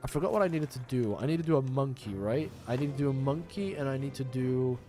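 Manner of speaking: ordinary talk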